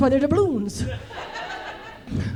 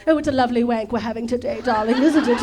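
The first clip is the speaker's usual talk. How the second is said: quick, lower mock English accent; 4 semitones lower, faster than usual. Spicing it up for a lady, mock English accent. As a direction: put on a chatty English accent. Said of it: "like, the English accent".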